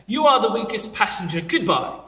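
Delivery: high British quiz-host dismissal; mock British send-off, starting high; Weakest Link host. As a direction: imitate a cutting British game-show hostess. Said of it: "sounded like the lady off of The Weakest Link".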